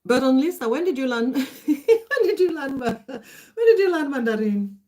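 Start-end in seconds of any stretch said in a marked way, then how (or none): 1.4-4.8 laughing question. Real, not acted.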